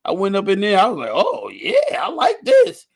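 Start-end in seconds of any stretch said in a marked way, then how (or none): 1.1-2.9 very high, loud exaggerated pleasure; acted delight. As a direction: squeal it as hammed-up bliss. Acts out enjoying his first massage.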